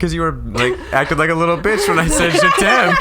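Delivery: high, loud, laughing whine; mock boyfriend whine. Friend mocking the boyfriend's complaint, high and laughing.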